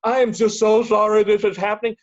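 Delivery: gasping, high, loud over-apology; exaggerated panicky squeal, easing down as it goes; hammed-up flustered. Shown as a demonstration. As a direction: gasp and wail a mock apology. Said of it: "don't get all flustered and go".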